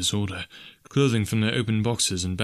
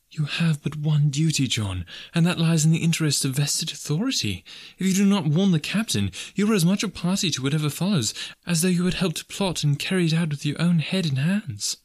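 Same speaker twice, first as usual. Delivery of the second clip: higher, firm voice of a dutiful wife; earnest young wife; 7 semitones higher than usual. Acted. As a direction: press him gently but firmly on his duty. Alice's lines in the story.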